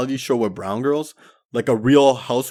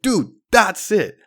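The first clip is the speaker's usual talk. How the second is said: much higher, slow, delighted approval; hyped-up buddy, higher and drawn out; impressed buddy; 8 semitones higher, 4 dB louder than usual. Retold or imitated. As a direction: praise it like he finally gets it. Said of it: "my buddy was like".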